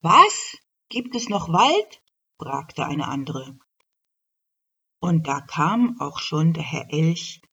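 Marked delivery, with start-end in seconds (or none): 0.0-2.0 much higher, louder astonished cry; astonished clerk. Acted. Clerk can't believe forests still exist, astonished. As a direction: gasp it in disbelief.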